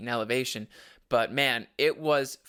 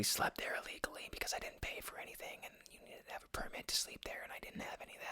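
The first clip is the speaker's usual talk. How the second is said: whispered confession; 17 dB quieter, faster than usual. Real, not acted. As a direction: whisper it like a secret. Confessing illegal sleeping.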